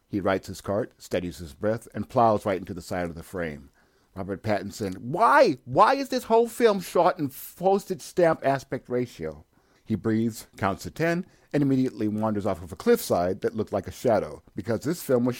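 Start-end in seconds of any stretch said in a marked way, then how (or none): 5.0-9.4 much higher, louder frustrated shout; exasperated yelling. Acted. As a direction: cry out at the sky in disbelief. Exasperated outburst at film.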